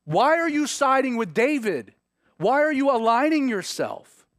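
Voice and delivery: a father berating his son, pressing each question harder; furious king. Retold or imitated. Saul rebukes son, rising fury.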